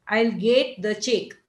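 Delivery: slow Indian English, get as gate, check drawn out; Indian English accent. Shown as a demonstration. Teacher imitating an Indian speaker's Indian English accent. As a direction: stretch get and check the Marathi way. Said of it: "an Indian person might, uh, say this like this".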